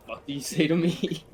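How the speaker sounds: rude retort. Retold or imitated. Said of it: "That's all he says".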